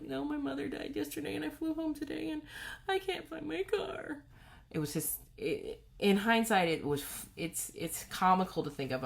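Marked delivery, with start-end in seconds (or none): 0.0-4.2 hysterical crying. Retold or imitated. To the parking attendants, hysterical and tearful. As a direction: pour out the grief in a broken plea. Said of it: "I was just in hysterics".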